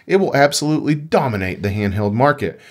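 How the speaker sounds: ordinary talk, nothing marked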